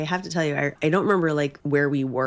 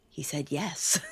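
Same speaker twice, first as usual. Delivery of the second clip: shocked; 4 dB quieter, slower than usual. Retold or imitated. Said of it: "me being shocked".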